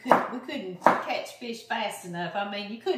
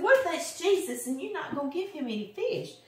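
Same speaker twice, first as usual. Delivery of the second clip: shaming; 7 semitones higher than usual. Retold or imitated. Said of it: "I was trying to shame John".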